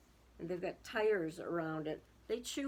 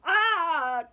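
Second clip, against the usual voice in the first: loud, high cry of alarm; storybook turkey's loud shriek; alarmed scream; 8 semitones higher, 12 dB louder than usual. A narrator's reading. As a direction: yell out as if fleeing.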